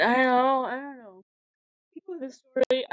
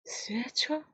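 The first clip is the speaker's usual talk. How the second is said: mock whisper; 3 semitones higher, 10 dB quieter than usual. Mocking echo of 成長.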